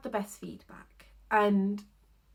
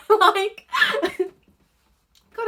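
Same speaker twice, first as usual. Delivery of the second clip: giggling with hiccups; high, loud laughing fit; 13 semitones higher, 11 dB louder than usual. Real, not acted. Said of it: "I'm hiccuping now".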